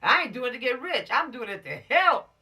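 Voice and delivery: loud, high insistence; emphatic shout. Real, not acted. Teacher to the teens, shouting her point. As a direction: shout it out with conviction.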